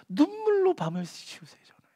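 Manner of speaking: breathy whisper of night tears; hushed whisper. Acted. Preacher whispering David's tearful nights to the congregation. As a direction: whisper it like weeping alone.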